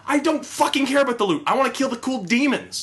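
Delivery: high-pitched, fired-up rant; excited rant. Real, not acted. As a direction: push it out with fervor. Ranting about loot.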